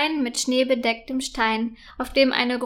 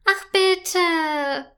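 pleading whine, pitch higher; whining child; 5 semitones higher than usual. Acted. Princess begs her mother to let her go, whiny. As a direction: beg like a spoiled little girl, lightly. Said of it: "quengelt Prinzessin Felia".

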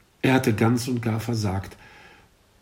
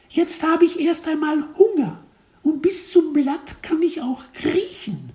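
very high, squeaky little voice; cartoon kid voice; 4 dB louder than usual. A narrator's reading. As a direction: speak like a cheeky tiny caterpillar. Caterpillar answers teacher.

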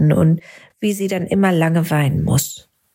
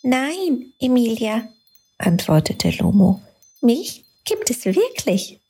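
lightly higher, kind light spirit; little light Lumo; 4 semitones higher than usual. A narrator's reading. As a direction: reassure the girl gently. Lumo answers Emilia. (leicht höhere, freundliche Lichtstimme)

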